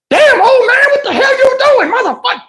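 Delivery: high-pitched imitation of a woman cussing; mock angry cussing. Woman cusses the old man who knocked her bottle, mock angry. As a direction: cuss him out in a put-on high voice. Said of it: "She started cussing at him".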